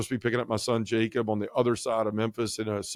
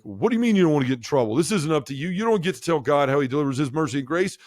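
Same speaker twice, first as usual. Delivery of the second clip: higher, louder, faster scolding rant; retold yelling priest; 6 semitones higher, 3 dB louder than usual. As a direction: scold him like an indignant confessor. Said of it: "stop yelling at me".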